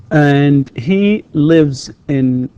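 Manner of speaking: very slow, loud model with "lives" ending in z; voiced Z ending. Shown as a demonstration. Teacher showing S is written but Z pronounced, slow model. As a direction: stretch it out, buzzing the end of "lives". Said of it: "تكتب S ولكن تنطق".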